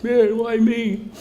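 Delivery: high, slow self-pitying whine; pity party whine. Retold or imitated. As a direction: moan it out slowly like a sulking man. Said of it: "having the mother of all pity parties".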